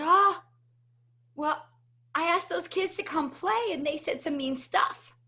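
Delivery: imitated upset little kid, high-pitched; tearful child. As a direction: complain to mom as a crying five-year-old, mildly acted. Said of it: "And I'm crying".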